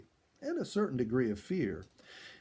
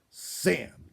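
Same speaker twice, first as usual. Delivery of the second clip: sharp; 4 semitones higher, 5 dB louder than usual. A narrator's reading. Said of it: "Ginny said sharply".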